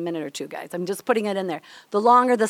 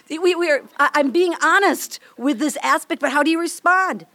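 normal talking voice

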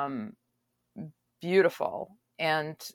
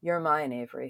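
normal talking voice

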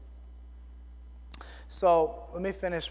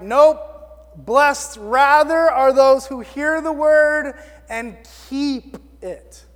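loud, higher, slow proclamation; emphatic correction; 4 semitones higher, 8 dB louder, slower than usual. Retold or imitated. Jesus answering the woman in the crowd, emphatic correction. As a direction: counter firmly, stretching the words out.

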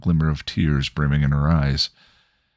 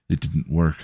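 usual speaking voice

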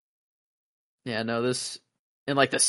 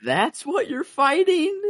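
incredulous; slower than usual. Retold or imitated.

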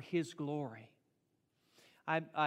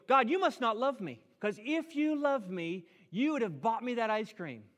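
put-on higher, louder pouting child begging for ice cream; whiny child; 6 semitones higher, 6 dB louder than usual. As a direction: sulk like a kid denied a treat. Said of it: "Do we sound like a, a three-year-old?".

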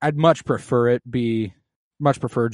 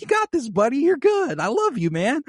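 much higher, cheery pep talk; mock-sweet encouragement, pitched up; encouraging Harwin; 13 semitones higher, 3 dB louder than usual. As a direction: hype up the kid like a proud dad. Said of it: "and Harwin's like".

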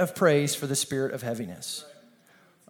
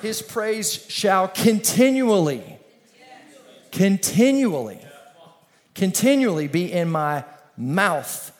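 slow, drawn-out "continually", repeated and stretched; slow declaiming; 2 semitones higher, 4 dB louder, slower than usual. Acted. Preacher to congregation, slow emphatic scripture declaiming. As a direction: stretch each "continually" as if savouring it.

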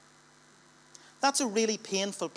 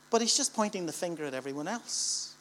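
normal talking voice

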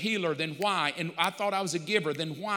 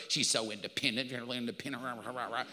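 a muttered, much softer mocking rant; mocking grumble; 6 semitones lower, 6 dB quieter than usual. Husband grumbling to God about his wife, mocking mutter. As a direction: grumble it low like a sulking husband.

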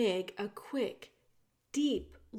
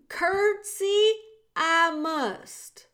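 much louder and higher, forceful yell; shouting; 8 semitones higher, 9 dB louder, much slower than usual. A narrator's reading. Meg shouting at the princess before curtsying. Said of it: "shouted Meg".

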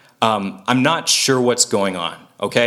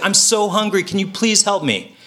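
put-on desperate child; 7 semitones higher than usual. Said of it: "sometimes there's desperation".

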